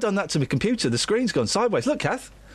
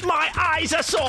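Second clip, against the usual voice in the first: high-pitched, daft crank voice; mock lunatic; 9 semitones higher than usual. Imagining a nut job's questions, mock lunatic voice. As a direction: ask it like a baffled oddball, up high. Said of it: "someone who types like that".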